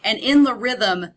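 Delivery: usual speaking voice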